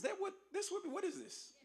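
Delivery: high, soft double-take at his own math; puzzled. Real, not acted. Speaker to the freshmen, catching his own math slip, high and puzzled. Mock confused about the year.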